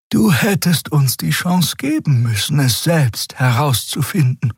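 high, measured character voice; calm Xea. A narrator's reading. Xea gently reproaching the captain for deciding alone, calm and high. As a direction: reproach evenly, pitched high. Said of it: "Xeas Stimme blieb ruhig wie immer".

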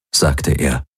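usual speaking voice

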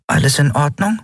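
high, anxious small-creature voice; tiny companion checking on a friend, high and worried; worried little creature. A narrator's reading. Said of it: "sah ihn besorgt an".

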